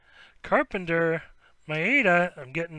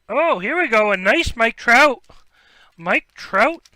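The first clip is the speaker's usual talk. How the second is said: louder, higher, thrilled; excited pull; 4 semitones higher, 9 dB louder than usual. Real, not acted. Breaker revealing a Mike Trout to viewers, louder with real thrill. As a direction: hype up the big hit. Big star card pulled live.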